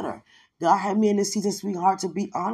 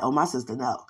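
friend insisting, low; 8 semitones lower, 4 dB quieter, faster than usual. Retold or imitated. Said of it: "she was like".

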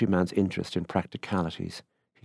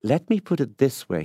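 quick, higher and louder interjection; 7 semitones higher, 5 dB louder than usual. A narrator's reading. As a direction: jump in briskly at a raised pitch. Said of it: "he said quickly".

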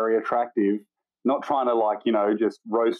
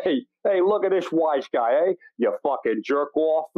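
pitched-up New York wise guy, slowing at the end; 4 semitones higher than usual. Retold or imitated. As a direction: heckle like a Brooklyn tough. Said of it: "I fucking love that New York accent".

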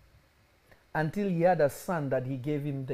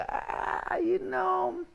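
dismissive son; much slower than usual. Retold or imitated.

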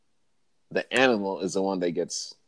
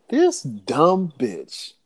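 higher, slow, unimpressed lion voice; lion sizing up a fool, raised and drawn out; voicing the lion; 6 semitones higher, much slower than usual. Lion judging woman who crossed barrier, slow mock disdain. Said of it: "and just looking, it's like".

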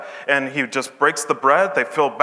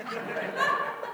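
airy laugh; 12 dB quieter than usual. Real, not acted. Laughs at own joke.

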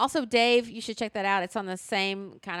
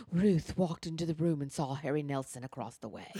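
audiobook narrator in a put-on British accent, pitched lower; 4 semitones lower than usual. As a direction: read it out like a British woman narrating a mystery. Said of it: "a woman with a British accent saying".

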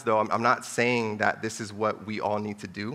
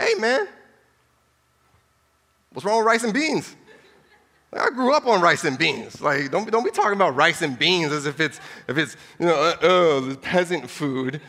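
much higher and louder, laughing in mock outrage; mock indignant; 5 semitones higher, 6 dB louder than usual. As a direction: defend your rice and beans with playful indignation. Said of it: "I'd be like".

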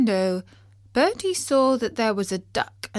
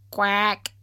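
short imitated quack; single voiced quack; duck quack. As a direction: make a duck's quack.

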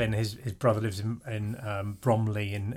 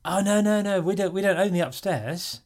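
higher, slowing put-on brother's voice; imitating the brother; 10 semitones higher, 4 dB louder, slower than usual. Brother explaining the flat to his guest, high mock voice. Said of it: "He goes".

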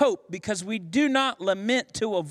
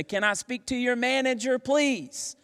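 higher-pitched customer asking for the manager; mock customer request, pitched up; complaining customer; 6 semitones higher than usual. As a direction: lightly act out an insistent restaurant complaint. Acting out a restaurant complaint.

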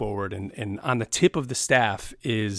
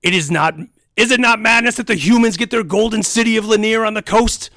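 much higher, louder furious tirade; ranting orc warlord; 9 semitones higher, 9 dB louder than usual. Acted. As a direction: hurl every grievance. Villain rant, loud.

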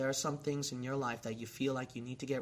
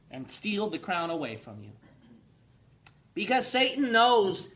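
loud, fiery sermon, louder and higher; preaching at full force; 4 semitones higher, 10 dB louder than usual. Acted. Preacher warning the congregation about Satan, shouted. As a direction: pound it out from the pulpit.

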